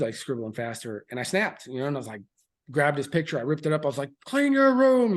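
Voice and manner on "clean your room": much higher, louder barked order; Marine dad yell. Retold or imitated. Father yells at his five-year-old son to clean room, loud barked order. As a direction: snap the order like a drill sergeant. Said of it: "Marine dad comes out".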